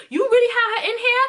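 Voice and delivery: high-pitched incredulous accusation; retold heated outrage. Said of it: "So I'm really heated".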